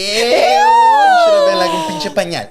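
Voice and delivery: very high, loud, slow laughing shout; mock shout. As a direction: yell the comeback, cracking up. Mock comeback suggestion, shouting.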